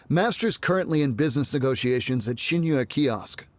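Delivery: normal talking voice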